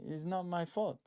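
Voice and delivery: attempted Italian accent. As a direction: try an Italian accent under your breath. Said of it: "I can't do the Italian accent".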